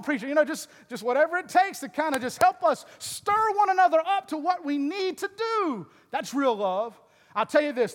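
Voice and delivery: high-pitched, loud pulpit delivery; full-force preaching. Acted. Pastor urging the church to stir one another up, full-force preaching. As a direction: lift the voice and exhort the room.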